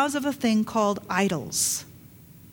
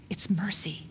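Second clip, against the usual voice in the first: breathy whispered repeat; whispered echo of the first take; 5 semitones lower, 8 dB quieter than usual. Real, not acted. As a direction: whisper it like a secret.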